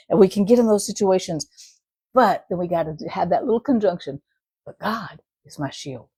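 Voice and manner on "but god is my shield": soft prayerful. Retold or imitated. Quoted prayer of trust.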